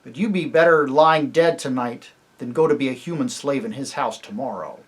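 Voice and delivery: plain reading voice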